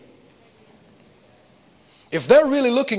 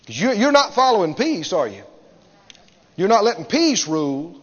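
ordinary delivery

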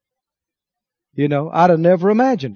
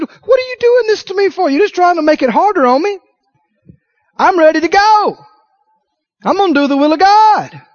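high, forceful rebuttal; impassioned protest; 10 semitones higher, 4 dB louder than usual. Acted. Paul answering friends who beg him to stay, impassioned and resolute. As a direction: protest high, then declare it firmly.

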